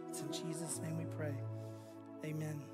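ordinary delivery

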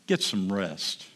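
soft, slow and low, soothing; gentle. Acted. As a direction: ease him into resting, tenderly. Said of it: "touching his sleeve gently".

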